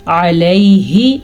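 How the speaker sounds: "علیہ" slow, squeezed ayn and gliding ay; throat ayn. Shown as a demonstration. Teacher modelling ayn, "علیہ" slow and throaty. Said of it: "عین کے تلفظ کا دھیان".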